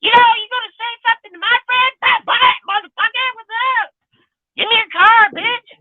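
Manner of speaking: very high, fast squeaky voice. Retold or imitated. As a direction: squeal it like a wild little-voiced thug. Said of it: "Every time I was around this guy".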